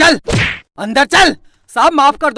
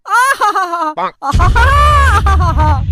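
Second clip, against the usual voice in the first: blissful sighs; 3 semitones higher, 4 dB louder, much slower than usual. Acted. Enjoying cool fan breeze.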